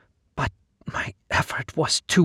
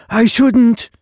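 I shouldn't said much higher and louder, a dim-witted refusal; simpleton repeating; 10 semitones higher, 9 dB louder than usual. Acted. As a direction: blurt it out high like a simple slave. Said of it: "he repeated".

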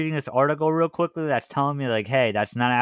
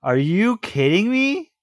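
disbelief; 7 semitones higher, 4 dB louder, slower than usual. Real, not acted. Reacts to the BMW subscription news.

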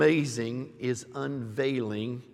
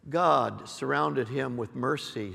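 ordinary talk, nothing marked

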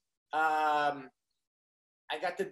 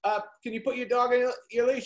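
insistent; 5 semitones higher, 5 dB louder than usual. Retold or imitated. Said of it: "I go".

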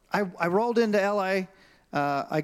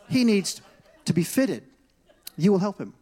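firm, raised-pitch command; insistent order; 3 semitones higher, 3 dB louder than usual. Retold or imitated. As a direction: lay down the law for a friend. Gershom acted ordering the salesman.

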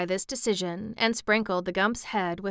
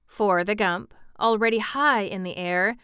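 usual speaking voice

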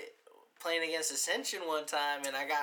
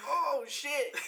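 retold shocked reaction; 10 semitones higher than usual. Retells reaction to seeing the collision.

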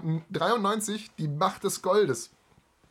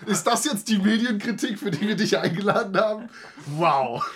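mock offended; 5 semitones higher, 5 dB louder than usual. Mock offended at a guest's jab.

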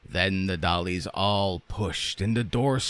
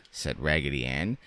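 ordinary delivery, nothing marked

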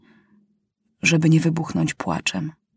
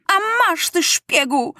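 high cry; 11 semitones higher than usual. A narrator's reading. Maciuś cries out at the journalist before drawing the revolver. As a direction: fling the word spy at him. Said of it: "krzyknął Maciuś".

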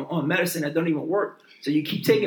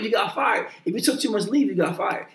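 usual speaking voice

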